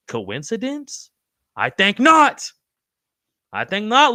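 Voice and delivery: slow "Coincidence?" then a loud, high "I think not"; mock dramatic. Host answering a chat comment with a mock-dramatic "I think not". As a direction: ham up the conspiracy reveal.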